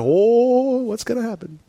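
high, drawn-out "oh", then hushed; imitating a boy awaiting trouble; little brother taunt. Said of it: "thirteen-year-old little brother boys".

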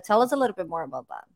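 normal talking voice

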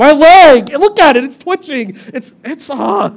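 high, loud hammed-up panic; husband in car, mock panicked whine; mock freak-out. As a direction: complain to Chris like the knee's possessed. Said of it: "look at Chris and go".

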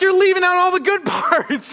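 loud, high exasperated outburst; mock protest. Husband to wife over her bare-facts story, loud mock protest. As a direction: protest it loudly, voice shooting up. Retelling own protest to Jenny.

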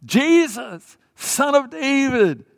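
high, drawn-out crying out; screaming out. Acted. Blind man calling to Jesus over a crowd, screaming plea. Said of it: "He screams at the top of his lungs".

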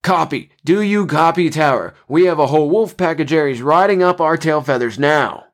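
high-pitched urgent radio call; shouting. A narrator's reading. Pilot radioing the tower about pursuers, shouting. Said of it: "a familiar voice was shouting".

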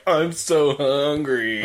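slow, mock whiny soldier voice; whining soldiers. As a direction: drag it out like a hungry grunt complaining. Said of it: "just to hear the soldiers whine".

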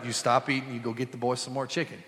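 usual speaking voice

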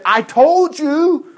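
high, drawn-out I-told-you-so; slow, scolding protest; acted reproach. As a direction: stretch it out in reproach. Reuben reproaching his brothers.

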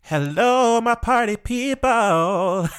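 high, slow, drawn-out sing-song greeting; booming sing song welcome. Acted. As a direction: belt the welcome out like a host. Greeting listeners at episode start.

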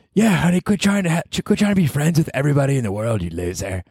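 high put-on girlfriend voice; mock girlfriend. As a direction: tease him as his bratty girlfriend. Mock girlfriend voice scolding him.

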